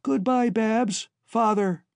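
high, drawn-out little farewell; tiny voice. Acted. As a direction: call a slow goodbye up from far below. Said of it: "his tiny voice echoed up".

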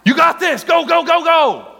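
loud, high encouraging shout; cheering runner on. Acted.

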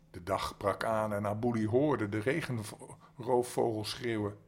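lively bedtime-story reading voice; story narration. A narrator's reading. Reading a spooky fairy tale to young listeners, lively storytelling. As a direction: read the tale to children in a lively voice.

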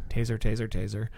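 calm, quiet, unhurried taser call; mock calm announcement before firing. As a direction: say it flatly calm, no urgency. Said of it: "says it all calmly".

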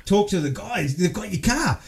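put-on a kid telling dad they've got his car; lightly voiced giggling boy; excited kid. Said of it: "I'm like".

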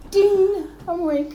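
high, bright-eyed mock jolt awake; playful imitation of a friend snapping awake; mock sudden wake-up. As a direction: chirp it like a bell just went off in her head. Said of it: "She's like".

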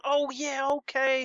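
high hyped "oh yeah, okay". Retold or imitated.

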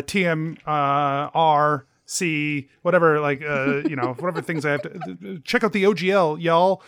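ordinary talk, nothing marked

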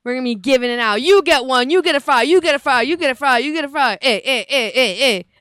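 loud, chanting prize-giveaway host; mock giveaway shouting.

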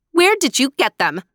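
bossy, accusing question; demanding. A narrator's reading. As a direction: demand an answer at once. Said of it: "Helena demanded".

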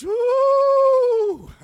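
high, loud shout of joy; joyful whoop. Retold or imitated. Church lady hearing God answered her prayer, whooping for joy. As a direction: let out a delighted woo. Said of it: "Oh, she's".

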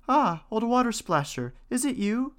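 put-on high, bright-eyed young princess. A narrator's reading. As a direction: lift it up into a girlish princess. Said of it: "said she".

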